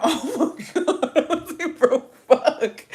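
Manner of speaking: high, loud laughing exclamation; laughing disbelief. Real, not acted. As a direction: crack up while retelling your shock. Laughing while retelling her shocked reaction.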